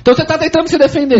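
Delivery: quick mocking jab; teasing, high and fast. Real, not acted. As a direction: tease him, fast and high. Teasing accusation.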